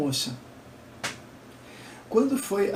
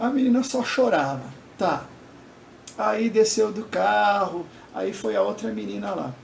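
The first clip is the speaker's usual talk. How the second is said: ordinary talk, nothing marked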